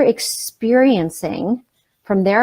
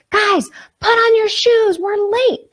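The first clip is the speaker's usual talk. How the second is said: put-on high-pitched, drawn-out call to the kids; mock yell, pitched way up; frazzled parental yell; 14 semitones higher, 4 dB louder, slower than usual. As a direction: holler it urgently, like a stressed parent.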